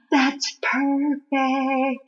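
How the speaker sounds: gasp, then a slow, breathy-soft "That's perfect"; a gasp of delight, then savour the words slowly. Retold or imitated. Reliving her delight at the kite scene, gasp and slow soft praise. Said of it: "I'm like".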